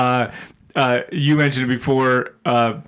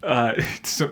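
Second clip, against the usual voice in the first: usual speaking voice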